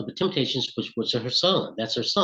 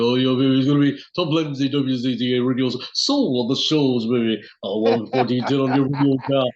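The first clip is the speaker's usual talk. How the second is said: smooth, louder late-night soul DJ patter; radio DJ voice; 4 dB louder than usual. Retold or imitated. Imitating the DJ Theodore Lindsay on his radio show, louder and smooth. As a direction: croon it like a radio host working the dial. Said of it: "this is how he sounded on the radio".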